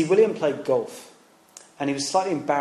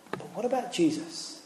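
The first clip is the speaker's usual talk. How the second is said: ordinary delivery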